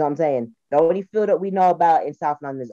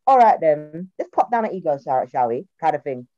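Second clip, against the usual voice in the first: ordinary delivery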